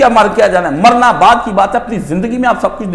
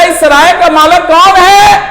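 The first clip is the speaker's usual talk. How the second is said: high, drawn-out fakir's cry; loud call; 8 semitones higher, 5 dB louder than usual. Retold or imitated. Fakir calling outside the king's palace, loud drawn-out call. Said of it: "بلند آواز سے آ کے اس نے آواز دی".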